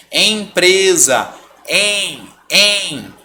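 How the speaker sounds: EM as a long nasal E, like E with a tilde; nasal vowel. Shown as a demonstration. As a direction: draw out EMPRESA on a nasal EM. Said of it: "como se você tivesse um tio no E".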